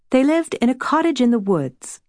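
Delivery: ordinary delivery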